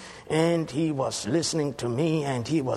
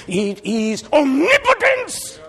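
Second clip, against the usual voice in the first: preaching shout; 9 dB louder than usual. Real, not acted.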